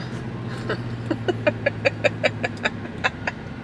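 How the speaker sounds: long, soft chuckle. Real, not acted. Playing down her workout to viewers, soft chuckle. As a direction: let out a long, quiet run of little laughs.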